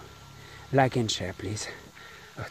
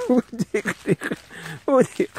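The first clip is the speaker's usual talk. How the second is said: nervous laugh; 10 semitones higher, 4 dB louder than usual. Real, not acted. Laughing in fright.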